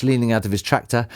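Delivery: usual speaking voice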